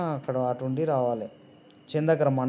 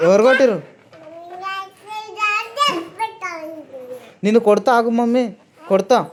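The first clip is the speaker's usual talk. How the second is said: mock threat; 12 semitones higher, 5 dB louder than usual.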